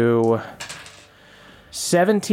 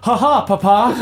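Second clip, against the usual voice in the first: hammed-up son; 6 semitones higher, 3 dB louder than usual. Son answers father in character.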